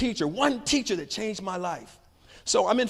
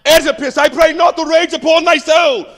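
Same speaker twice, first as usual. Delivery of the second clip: booming, much higher and louder grand stage delivery; Royal Shakespeare style; 11 semitones higher, 15 dB louder than usual. Retold or imitated. Boy showing off Royal Shakespeare reading to class, booming. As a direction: declaim it like a Shakespearean actor. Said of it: "So I read it like this".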